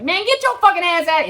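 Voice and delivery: very high, loud mock yell; mom yelling at kids. Mother yelling at her kids by the road, very high.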